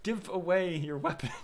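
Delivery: high, loud pleading cry at Kratos; mock yell. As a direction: shout a warning at the screen, half joking. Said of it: "But I'm just like".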